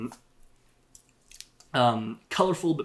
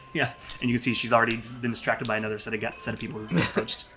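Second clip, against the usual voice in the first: ordinary talk, nothing marked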